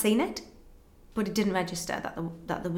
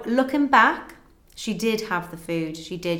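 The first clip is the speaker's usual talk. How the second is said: usual speaking voice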